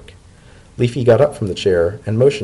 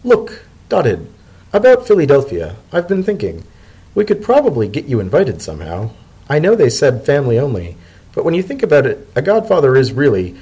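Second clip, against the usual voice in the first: higher Leafy voice, hesitant; reluctant Leafy; 5 semitones higher, 3 dB louder than usual. Acted. Leafy reluctantly offers to get Dotted invited, hesitant. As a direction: ease into it reluctantly. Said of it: "with considerable reluctance".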